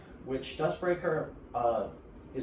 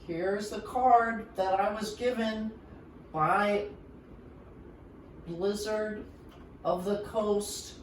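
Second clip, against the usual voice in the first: excited announcement; 9 semitones higher, 4 dB louder than usual. Real, not acted. Grand reveal of the new card.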